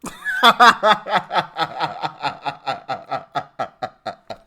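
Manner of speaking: big loud burst of laughter; long laugh. Real, not acted. Host cracking up at the clip, loud laughter.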